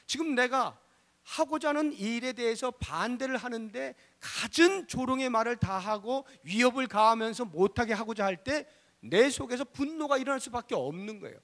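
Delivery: ordinary delivery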